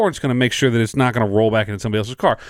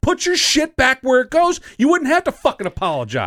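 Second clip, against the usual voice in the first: angry scolding; 14 semitones higher than usual. Retold or imitated. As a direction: chew her out hard. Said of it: "I said".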